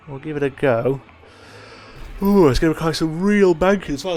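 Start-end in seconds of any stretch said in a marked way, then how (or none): 2.2-4.2 talking through a yawn. Real, not acted. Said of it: "I'm yawning my head off".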